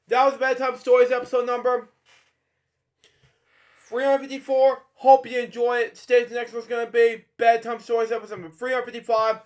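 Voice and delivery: bright, loud, quick outro; upbeat sign-off. Real, not acted. Host signing off to viewers, loud and quick. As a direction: wrap up the episode with a cheery push.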